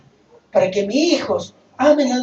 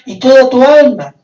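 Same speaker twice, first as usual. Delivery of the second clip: loud, raised preaching voice; forceful proclamation; 4 semitones higher, 13 dB louder than usual. Acted. Preacher continues the commandment to love God, forceful proclamation. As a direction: ring the phrase out to the congregation.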